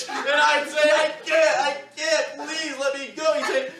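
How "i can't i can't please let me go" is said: pinned in a chokehold, yelling for mercy; panicked begging. Retold or imitated. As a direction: beg him to let go, voice straining high.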